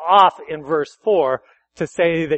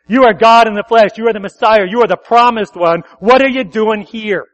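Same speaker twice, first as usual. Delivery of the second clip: demon crying out; 4 semitones higher, 8 dB louder than usual. Acted.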